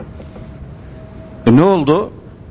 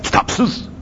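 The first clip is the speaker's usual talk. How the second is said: whispered; 3 dB quieter than usual. Real, not acted. Bitter whispered repetition.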